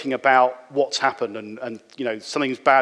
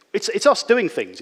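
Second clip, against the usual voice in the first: ordinary delivery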